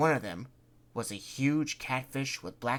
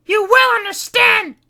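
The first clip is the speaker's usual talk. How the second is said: much higher, much louder slow scream; 17 dB louder than usual. Acted. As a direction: shriek each word out. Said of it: "Kitty screamed".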